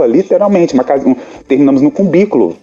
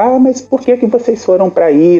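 lightly voiced aunts, pitched high; higher-pitched imitation of fussing aunts; complaining aunts; 7 semitones higher than usual. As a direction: mimic the aunts' protesting question. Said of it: "aí minhas tias, né, ficava".

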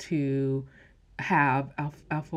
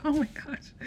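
high, breathy shocked "oh my gosh"; mock shocked reaction; shocked gasp; 11 semitones higher than usual. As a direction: gasp it out, caught off guard. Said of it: "I was like".

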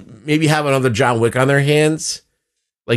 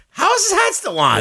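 very high comic squawk of bewilderment; baffled disbelief. Retold or imitated. Viewer reacting to the hat that never falls, incredulous. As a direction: shoot the pitch way up, mock-baffled.

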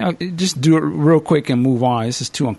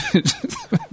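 high laugh; 4 dB quieter than usual. Real, not acted. Laughs at own accent.

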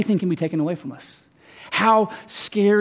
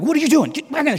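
retold alarmed scolding; 10 semitones higher, much faster than usual. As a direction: shoo someone back in a high, frantic voice. Scolding wife who followed him.

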